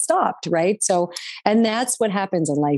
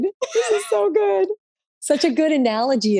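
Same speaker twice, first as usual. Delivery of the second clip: laughing; 11 semitones higher than usual. Real, not acted. Cracking up at analogy.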